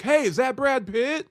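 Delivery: very high, loud excited shout; acted calling out. Staged person in line causing a scene, loud high shout. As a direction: call out to draw a crowd. Acting planted bystander calling out.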